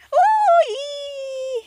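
high, loud squeal of delight; excited shriek. Real, not acted. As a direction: shriek with real surprise. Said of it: "I'm just get excited".

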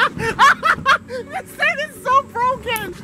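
very high, laughing through the words; helpless laughter. Real, not acted. Stuck on a broken thing, laughing helplessly. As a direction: squeal it out slowly through giggles.

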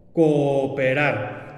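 cooperar, said very slowly; slow demo word. Shown as a demonstration. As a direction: draw out cooperar evenly. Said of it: "en la palabra cooperar". (pronunciación lenta de cooperar)

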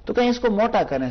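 ordinary delivery

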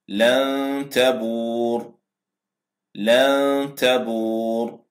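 لن تبور very slow, nun of لن hidden in a long nasal hum before the تاء; drawn-out لن تبور with held ghunna; nasal ikhfa demo. Shown as a demonstration. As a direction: recite لن تبور twice, slowly, nun in the nose. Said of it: "نون ساكنة جاء بعدها حرف التاء".

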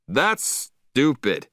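mild, drawn-out storybook shout; indignant shout. A narrator's reading. Man with Killer protesting about the leash rule, indignant shout. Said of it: "he shouted".

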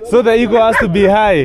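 laughing at the dog showing off. Real, not acted.

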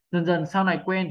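ordinary talk, nothing marked

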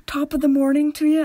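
hammed-up eager tourist imitation; American tourist. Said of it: "it was like an American tourist".

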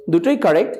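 ordinary talk, nothing marked

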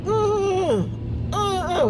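put-on high protesting toddler grunts; jealous toddler. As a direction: imitate a jealous baby fussing. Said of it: "kinda jealous that he was there".

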